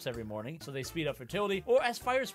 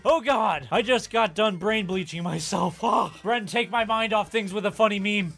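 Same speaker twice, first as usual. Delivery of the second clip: loud mock despair; 2 semitones higher, 7 dB louder than usual. Exaggerated disgust plea.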